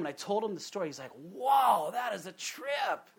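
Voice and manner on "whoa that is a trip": high, stretched-out impression of an amazed boss; boss's amazed drawl. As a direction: imitate your boss's slow, high-pitched amazement. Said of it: "he used to talk like that".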